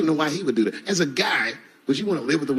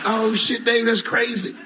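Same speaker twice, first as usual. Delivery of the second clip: impressed buddies; 8 semitones higher, much slower than usual. Retold or imitated. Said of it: "they're all like".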